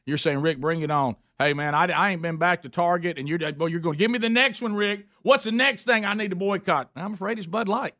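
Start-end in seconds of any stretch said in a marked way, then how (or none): none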